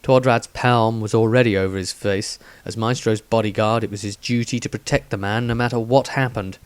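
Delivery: ordinary delivery, nothing marked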